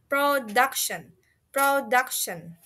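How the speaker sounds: slow, drilled "production", DUC stressed; stress on middle syllable. Shown as a demonstration. Teacher shows how it should sound, slow "production" stressed on DUC. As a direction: draw out "production" with the weight on DUC. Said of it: "it should sound that".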